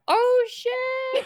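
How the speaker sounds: amazed gasp. Acted. Gasps at ice water lemon.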